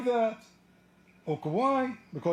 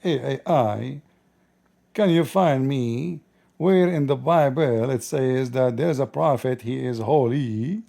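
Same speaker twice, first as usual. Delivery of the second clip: slow mocking imitation of him asking AI; slow sarcastic voice of a desperate searcher; mock query to AI; 7 semitones lower, 6 dB louder, slower than usual. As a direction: drag it out, ridiculing his search. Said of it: "he will call A I".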